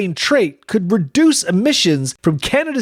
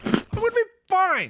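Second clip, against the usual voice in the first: higher, panicked self-reassurance; more scared, voice climbing; panicky; 12 semitones higher, 6 dB quieter than usual. Acted. Repeated, even higher and more panicked.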